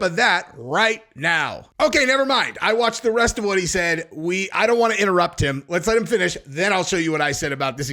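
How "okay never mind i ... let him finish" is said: usual speaking voice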